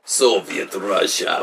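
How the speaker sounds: slow mock-Russian accent; slow, hammed-up Russian guy. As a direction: stretch it out in a fake Russian accent. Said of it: "I can do a Russian guy".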